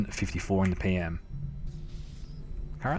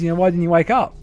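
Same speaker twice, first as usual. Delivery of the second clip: high, louder dad question; imitating dad; 9 semitones higher, 5 dB louder, faster than usual. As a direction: needle him as dad, voice pitched up. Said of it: "He goes".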